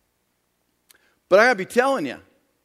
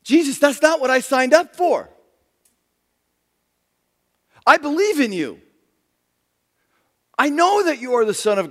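higher, louder protesting disciple; alarmed disciple; 6 semitones higher, 5 dB louder than usual. Acted. Disciple protesting to Jesus, higher and louder in alarm. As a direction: push back in panic at the master.

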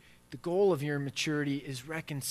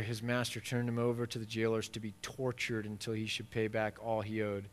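ordinary talk

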